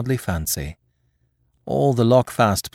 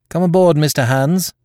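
high, loud mock-formal hail; ironic; 9 semitones higher, 8 dB louder than usual. A narrator's reading. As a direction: call out with ironic politeness. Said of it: "I said ironically".

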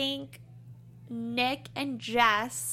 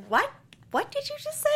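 shocked disbelief; 12 semitones higher, faster than usual. Retold or imitated. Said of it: "I was like".

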